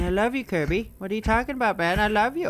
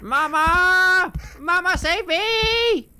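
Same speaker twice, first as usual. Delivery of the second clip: high, loud, drawn-out wail; mock-panicked high yell; hammed-up cry for help; 11 semitones higher, 4 dB louder, much slower than usual. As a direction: wail for rescue like a scared child. Crying for Mama in game.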